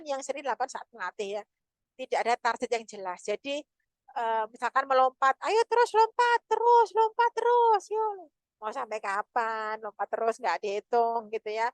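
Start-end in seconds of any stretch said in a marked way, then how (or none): 5.4-8.3 much higher, louder coaxing mom voice; chirpy push to keep the child jumping; eager parent cheering. Retold or imitated. Mother urging her child to keep jumping, high and loud.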